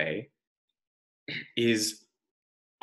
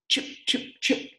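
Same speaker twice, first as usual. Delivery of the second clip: chip sound; 14 semitones higher than usual. Acted. Hatchet sound.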